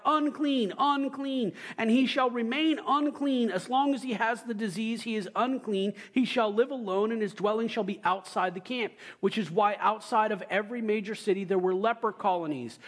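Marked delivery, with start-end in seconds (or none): 0.0-1.5 higher, falling warning calls; crying out unclean. Acted. Leper calling out to passers-by, a mild warning cry. 1.8-8.9 higher, formal Bible reading; scripture reading. Acted. Preacher reading Leviticus to the congregation, formal reading voice.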